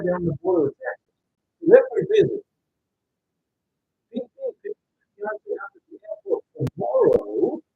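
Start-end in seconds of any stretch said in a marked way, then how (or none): 4.1-7.6 tender cooing. Real, not acted. Cooing to his partner on stream.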